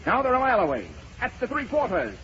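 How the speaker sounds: high-pitched track announcer; race caller. Acted. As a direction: call it like a horse race. Acted racetrack call of Napoleon's approach.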